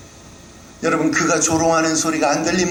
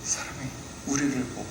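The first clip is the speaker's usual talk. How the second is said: normal talking voice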